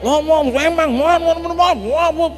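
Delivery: hammed-up furious nagging; mock raging woman. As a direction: mimic her nonstop ranting. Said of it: "tetap ngamuk-ngamuk".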